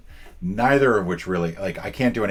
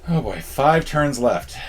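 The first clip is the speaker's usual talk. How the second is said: louder, higher, slow mock alarm; drawn-out dramatic worry about the clock; mock dread; 7 semitones higher, 6 dB louder, slower than usual. Real, not acted. Time running out in game.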